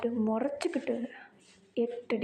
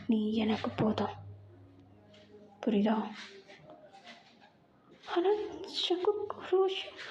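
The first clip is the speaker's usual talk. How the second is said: possessive lover. Acted. Jungkook voiced low, possessive. (தாழ்ந்த குரலில் உரிமை கொண்டாடும் காதலன்)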